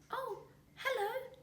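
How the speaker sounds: very high, slow timid chick voice; little kiwi. A narrator's reading. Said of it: "Kiwi replied".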